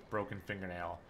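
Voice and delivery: usual speaking voice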